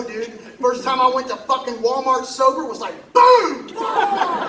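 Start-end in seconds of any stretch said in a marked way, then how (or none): 3.1-4.5 startled outburst. Retold or imitated. Shock at Walmart.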